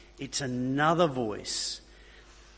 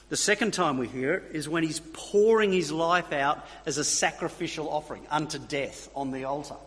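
usual speaking voice

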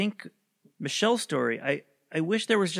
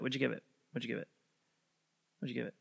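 each repeat softer and lower; fading repetition; 3 semitones lower, 9 dB quieter, faster than usual. Shown as a demonstration. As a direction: let the question fade away. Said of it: "repeats the question, fading".

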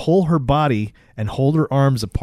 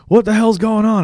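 high, raised mock alarm; alarmed demand; 10 semitones higher, 4 dB louder than usual. Everybody reacting to the ruckus, mock alarmed. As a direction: call it out with pitch jumping up.

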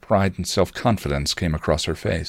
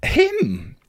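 excited exclaim; 14 semitones higher than usual. A narrator's reading. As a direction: blurt it out thrilled. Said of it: "Willow exclaimed excitedly".

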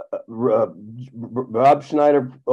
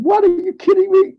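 high, disbelieving outburst; re-enacted can't-believe-it reaction; incredulous; 3 dB louder than usual. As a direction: voice leaps up in acted astonishment. Reacting to band wanting to meet.

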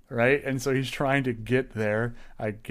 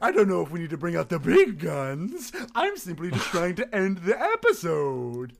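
mock-offended outro host; outro man voice; 5 semitones higher than usual. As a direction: fuss like a pompous host. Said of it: "outro man".

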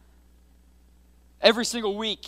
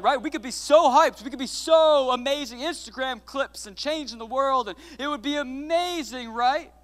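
excited; 6 semitones higher, 5 dB louder than usual. Retold or imitated. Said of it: "I was like".

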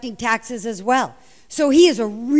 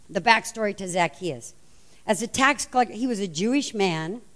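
normal talking voice

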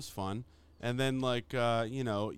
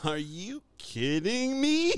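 high, slow, drawn-out disbelief; mock outrage; 9 semitones higher, 3 dB louder, much slower than usual. Actor reacts to losing out on job, high mock outrage. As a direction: stretch it out in exaggerated disbelief.

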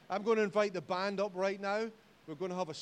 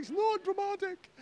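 high squeaky protest; indignant drama queen; 7 dB louder than usual. Retold or imitated. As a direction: deny it in a hammed-up whine. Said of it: "I'm like".

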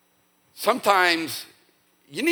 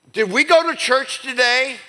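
mock indignant; 9 semitones higher, 6 dB louder than usual. Wife scolding husband.

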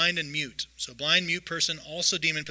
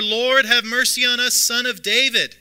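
much higher and louder, desperate shout; crying out all the more; 9 semitones higher, 11 dB louder than usual. Acted. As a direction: yell over the crowd that's shushing you. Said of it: "cried out all the more".

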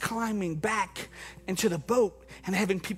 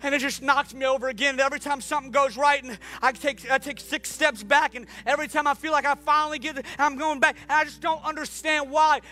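high, loud, fervent sermon voice; full-force preaching; 6 semitones higher, 4 dB louder than usual. Acted. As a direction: preach it out to the whole room.

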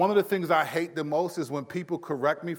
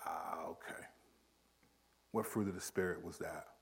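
quiet realization; 10 semitones lower, 16 dB quieter than usual. Retold or imitated. Inner realization, quiet aside.

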